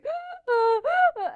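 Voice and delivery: high, sharp sob-gasp; sobbing gasp. Retold or imitated. Gasping in tears at the broken-down car, a sharp high sob. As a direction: catch the breath in a sudden high sob. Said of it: "And I was going".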